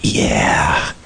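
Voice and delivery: mock boastful pastor; smug self-satisfaction. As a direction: play the proud preacher patting himself on the back. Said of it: "where you go like this".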